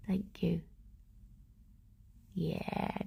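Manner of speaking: a hushed breathy "Thank you." to the rabbit; whispered thanks. Real, not acted. Thanking rabbit while grooming.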